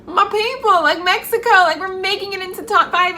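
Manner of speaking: excited fan squeal. Retold or imitated. Cheering Mexico into the top five, high excited squeal. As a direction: squeal it like a thrilled superfan. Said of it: "being like".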